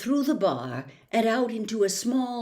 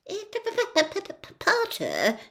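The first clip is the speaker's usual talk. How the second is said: nervous stutter, higher on the broken starts; stammering; 11 semitones higher than usual. Acted. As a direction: stutter out the name in fright. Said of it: "stammered Professor Quirrell".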